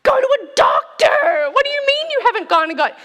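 high, loud, rushed yelling; panicked daughters shouting at dad through the phone; frantic shout. Retold or imitated. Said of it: "kind of screaming into the phone".

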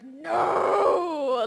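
imitating a three-year-old's furious shout; a loud, stubborn toddler NO; mock tantrum yell from her son; toddler anger. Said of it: "that three-year-old man-child anger".

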